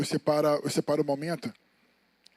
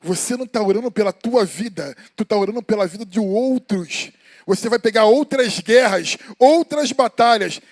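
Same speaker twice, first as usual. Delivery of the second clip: loud, driving preacher's call; fiery preaching; 2 semitones higher, 8 dB louder than usual. Acted. Preacher warns the church about other people's battles, loud and fiery. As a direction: hammer each phrase at the congregation.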